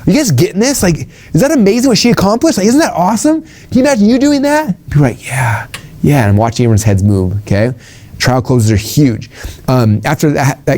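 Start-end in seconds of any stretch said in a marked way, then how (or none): 0.1-4.8 much higher, pumped-up speaker voice; hyped stage questions. Shown as a demonstration. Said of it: "I show it, and I'm like". 5.3-6.2 whispered yes. Retold or imitated. Audience answering the hype.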